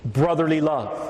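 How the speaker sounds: ordinary talk, nothing marked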